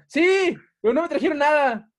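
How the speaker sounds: high, loud, aggrieved agreement; angry retold complaint. Child agreeing with papá about the Reyes, high aggrieved protest. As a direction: insist indignantly in a high voice. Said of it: "Y yo estaba bien enojado".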